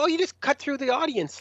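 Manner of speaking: high, airy mock directions; breezy staffer. Festival staff direct band through the crowd, high and breezy. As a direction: imitate someone casually explaining the absurd.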